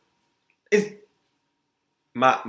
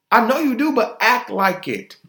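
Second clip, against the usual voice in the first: high and louder, pushing hard; emphatic outburst; 10 semitones higher, 5 dB louder than usual. Real, not acted. Telling Nicki to act humble, loud and high. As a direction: press the point up loud.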